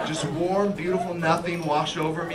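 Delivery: ordinary delivery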